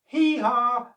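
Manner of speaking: high, loud hee-haw; monkey hooting. A narrator's reading. Second monkey laughing off the warning, high and loud. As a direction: whoop it like a silly monkey. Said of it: "said the other".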